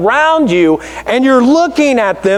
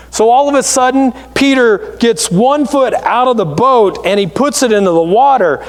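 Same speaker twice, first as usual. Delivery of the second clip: ordinary talk, nothing marked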